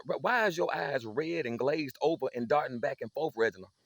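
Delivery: rapid, accusing list of symptoms; a mother cataloguing the evidence; mama grilling. Retold or imitated. Mama interrogates her high son.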